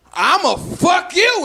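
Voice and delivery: copying a gangster's threat badly; failed tough threat. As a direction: try to sound hard and miss. Say what he said 'cause it sounded real good.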